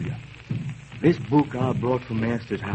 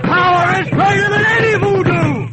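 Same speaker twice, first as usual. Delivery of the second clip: far higher, louder defiant proclamation; triumphant shout; 7 dB louder than usual. Acted. Toussaint proclaiming his power over voodoo, triumphant shout.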